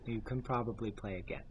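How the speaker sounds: soft, hushed pity for a gamer; mock consoling. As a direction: coo it softly like comforting a kid. Consoling John who is losing games.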